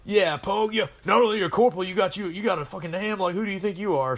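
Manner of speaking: razz the new corporal, fast and cocky; mocking taunt. Marine mocking a fresh corporal, a cocky taunt.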